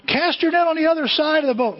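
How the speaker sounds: high-pitched holler from shore; calling out. Acted. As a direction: shout directions out to a boat. Man on shore calls to boat.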